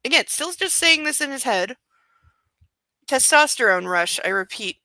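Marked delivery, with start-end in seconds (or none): none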